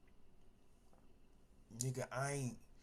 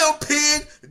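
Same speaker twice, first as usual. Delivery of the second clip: ordinary talk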